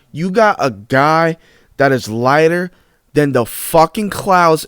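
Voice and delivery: very slow, punching each phrase; slow dramatic emphasis. Real, not acted. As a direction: drag it out for effect. Dramatic slow roast of Bird.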